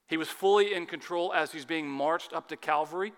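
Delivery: ordinary talk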